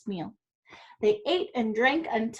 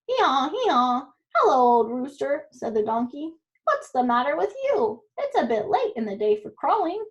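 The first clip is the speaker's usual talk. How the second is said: braying donkey; 2 semitones higher, 3 dB louder than usual. Acted. Donkey invites the cat to join the Bremen band, braying. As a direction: bray then coax like a cheery donkey.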